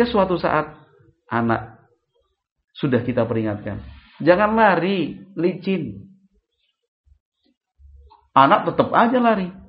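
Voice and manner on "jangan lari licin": parent's warning. Retold or imitated. Parent warns running child.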